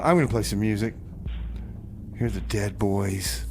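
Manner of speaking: ordinary delivery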